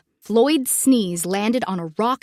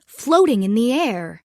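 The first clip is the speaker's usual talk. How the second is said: plain reading voice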